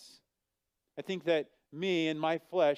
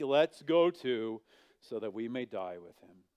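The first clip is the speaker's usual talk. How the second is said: lower, gloomy Eeyore drone; 6 semitones lower than usual. Retold or imitated. Thomas voiced as glum Eeyore, resigned and low. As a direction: sigh it out like Eeyore. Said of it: "like, Eeyore right here".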